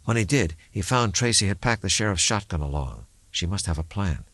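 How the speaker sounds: usual speaking voice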